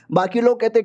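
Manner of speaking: ordinary delivery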